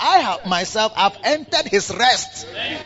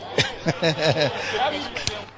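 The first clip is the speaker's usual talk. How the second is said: soft laugh; 5 dB quieter than usual. Real, not acted. Laugh alone on line.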